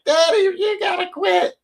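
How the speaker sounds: imitating his kids pleading with Daddy to retire; mock child's plea. Athlete imitating his kids, high pleading child's shout. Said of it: "my wife and kids to be like".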